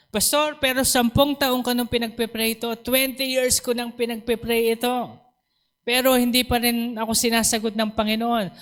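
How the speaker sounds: high, insistent mock grievance; acted complaint. Preacher voices members who prayed for years unanswered, complaining.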